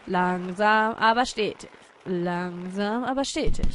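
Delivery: low, drawn-out mantra; slow and steady. Pretend. Player creeping up the mountain, slow mantra. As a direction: stretch out "Langsam" low.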